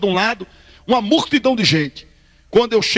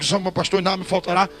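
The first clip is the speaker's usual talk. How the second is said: fast rehearsing mutter; 3 semitones lower, 4 dB quieter, faster than usual. Retold or imitated. Nervous before preaching, he rattles the verse off fast and soft. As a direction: rattle off "O Senhor é meu pastor e nada me faltará" fast and softer. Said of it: "Sem parar, pra não esquecer".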